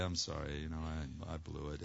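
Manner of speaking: low, soft admission of fault; acted subdued apology; sheepish apology. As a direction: own up quietly after the outburst. Sheepish apology on coming home.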